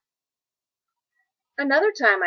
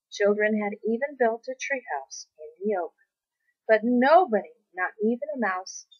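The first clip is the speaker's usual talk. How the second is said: plain reading voice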